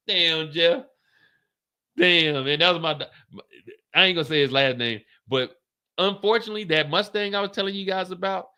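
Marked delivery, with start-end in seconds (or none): none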